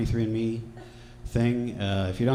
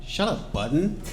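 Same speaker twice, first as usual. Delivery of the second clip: higher, drawn-out mock put-down; mocking dismissal; 7 semitones higher than usual. Revolutionaries cut Button off, mockingly dismissive. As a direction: dismiss him with a rising lilt.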